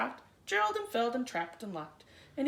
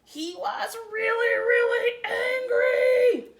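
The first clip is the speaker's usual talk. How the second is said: rising higher, louder and slow into angry; building anger; 9 semitones higher, 10 dB louder, much slower than usual. A narrator's reading. Reader telling of McGreely's anger, building loud and high. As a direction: stretch really out and explode on angry.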